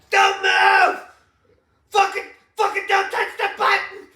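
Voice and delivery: high, loud frantic yell, laughing; hammed-up terrified shouting; mock panicked shout. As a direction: scream orders like a panicked gunman. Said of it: "You'd be back there with your gun".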